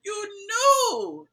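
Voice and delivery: high, loud accusing cry; mock accusing shout. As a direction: hurl the accusation. Acted accusation in recap.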